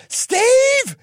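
high shrill-free mom call, loud; mom yelling name. Retold or imitated. Mom calling her son from downstairs, high loud yell. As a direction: call him like an angry mom.

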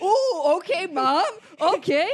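loud laughing ooh okay; taken aback. Retold or imitated. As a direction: react like you were just roasted. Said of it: "And I was like".

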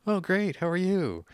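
high-pitched, chirpy small-talk voice; mock-cheerful "everything's great" reply; fake cheery greeting. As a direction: put on a high, polite social brightness. Mimics insincere social small-talk reply.

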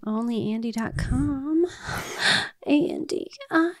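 breathy promo read; sultry narrator. Shown as a demonstration. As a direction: purr the web address. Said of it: "in your sexy voice".

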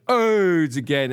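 high, slow, drawn-out AIDS again; retold intercom shout; mock PA call. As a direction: announce it over the intercom. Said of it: "grabs the last one and goes".

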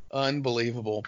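ordinary talk, nothing marked

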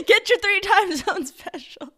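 high, loud hawker's cry, through laughter; laughing mock pitch. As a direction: sell it like a street vendor while cracking up. Guest echoes host as a mock ad.

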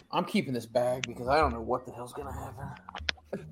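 whispered aside; whispering. Real, not acted. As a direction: say it under your breath. Quiet aside while feeling sick.

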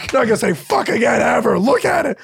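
very high, hammed-up punchline; comic high-pitched heckle; mock outburst. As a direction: push the joke up into a high squeal. Hammed-up joke about dad's punishment.

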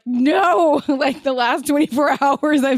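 a high, sharp yelp of protest; mock horrified no. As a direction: blurt it out high like hearing bad news. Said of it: "And I was like".

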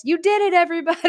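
very high, gleeful congratulation; excited cheer. Retold or imitated. Buddy congratulates the coffee shop staff, very high cheer. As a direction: cheer the shop like a proud child. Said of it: "He walks into the coffee cup place".

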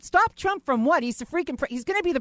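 high incredulous question. Real, not acted. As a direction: scoff at the idea, rising. Incredulous at protesters.

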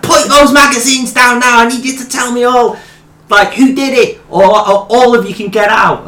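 put-on high Birmingham accent, stern threat; mock boss demanding names; Birmingham manager. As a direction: Birmingham manager raising his voice.

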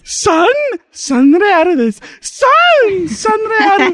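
high, slow mock Japanese cry; sing-song Japanese-style call; Japanese-style vendor call. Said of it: "a versão em japonês".

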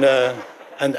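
ordinary talk

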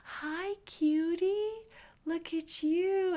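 high, slow cooing; doctor greeting the baby in sing-song baby talk; doctor's baby talk. Retold or imitated. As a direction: coo to the newborn, very high and slow. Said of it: "I heard the doctor go".

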